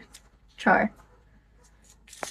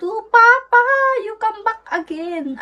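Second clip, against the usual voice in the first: high call out; 9 semitones higher than usual. Real, not acted. Calling out to a viewer on stream.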